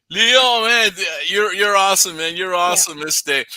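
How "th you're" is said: ordinary talk, nothing marked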